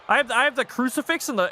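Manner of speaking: ordinary talk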